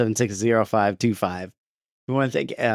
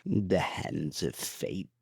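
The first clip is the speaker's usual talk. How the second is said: very slow, much softer evil Emperor; 3 semitones lower, 9 dB quieter, much slower than usual. Acted. Cohost reading patron names as the Emperor. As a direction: murmur it like a doom-laden sorcerer. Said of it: "Let's do it in Emperor voice".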